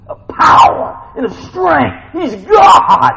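slow, emphatic, rising to a shout; powerful preacher's declaration; fervent proclamation. Real, not acted. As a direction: build to a loud, high climax. Preaching climax on God's power.

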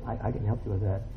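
very soft, low offer; small quiet voice. Acted. God nudging someone who forgets Him, small quiet voice. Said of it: "that little small knock on the door".

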